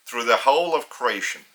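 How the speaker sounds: ordinary talk, nothing marked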